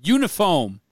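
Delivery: put-on "Uniform" hollered loud and high in the coach's accent; coach's pronunciation. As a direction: belt out "Uniform" like the movie coach. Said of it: "That's better. You're getting there".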